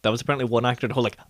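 normal talking voice

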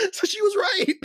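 high, laughing almost to tears. Real, not acted. Admitting girlfriend was right, laughing high. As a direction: give in to the laughter.

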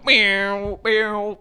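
playful crane sound meow meow; crane noise impression. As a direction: imitate a crane going meow. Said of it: "that's the best crane impression".

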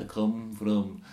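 put-on alien voice. Alien guest answering the podcast interviewers, slow and deliberate. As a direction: announce your home planet slowly, like a visiting alien. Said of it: "Why are you talking like that?".

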